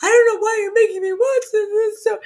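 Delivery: high, loud wailing complaint; retelling a sad dog scene to friends, mock wailing; whiny mock crying. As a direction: whine it like a tearful kid. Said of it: "and I'm like".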